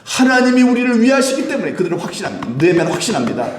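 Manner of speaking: high, shouted sermon conviction; full-force preaching. Real, not acted. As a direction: raise the voice high and preach with total certainty. (확신에 차서 높이 외치는 설교)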